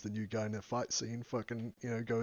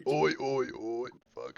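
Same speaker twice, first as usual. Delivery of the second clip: put-on Australian accent; 3 dB louder than usual. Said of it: "deep voice Witcher Australian".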